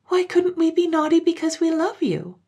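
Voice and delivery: put-on high, pleading child's question; high child voices. Children questioning their mother, high and pleading. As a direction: ask like a puzzled small child.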